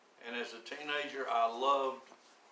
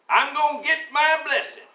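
much higher, louder quoted churchgoer; mock churchgoer; 13 dB louder than usual. Preacher mimicking people at church, high and loud. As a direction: belt it like an eager churchgoer.

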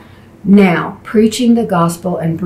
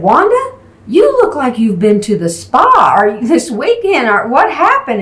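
much higher, delighted surprise; light up seeing her transformed; high surprised call to a friend; amazed greeting; 5 semitones higher than usual. Retold or imitated. Greeting healed woman, amazed.

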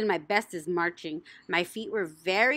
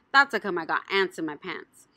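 plain reading voice

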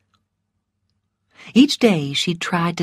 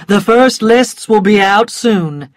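firm, slightly raised announcement; announcing news; 3 semitones higher, 5 dB louder than usual. A narrator's reading. Rhett tells the waiting crowd the news, announcing it. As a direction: call it out to those around you.

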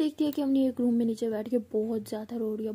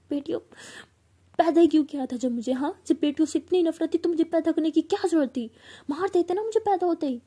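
high, loud, tearful shout of rage; shouting at mother; 6 semitones higher, 6 dB louder than usual. Retold or imitated. Daughter screaming at her mother through tears. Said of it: "ऐसे बहुत ज़ोर से चिल्लाते".

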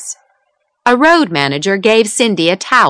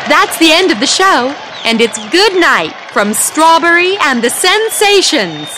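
raised, higher voice calling to a crowd; shouting into microphone; 9 semitones higher than usual. A narrator's reading. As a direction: announce the goodbye to the whole hall. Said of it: "shouted Cindy into the microphone".

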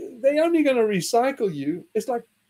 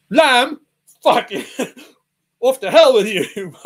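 mock outraged God; 4 semitones higher, 9 dB louder than usual. God banishing meat-asker.

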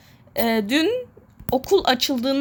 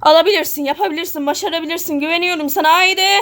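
higher-pitched pep talk, building to "Haydi"; rallying encouragement, pitched up; urgent cheering on; 5 semitones higher, faster than usual. Real, not acted. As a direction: urge them on eagerly. Cheers self or player on.

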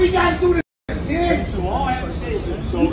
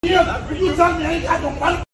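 fast, furious yelling; angry shout; 3 semitones higher than usual. Real, not acted. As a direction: yell it out in rage. Angry man yelling at group.

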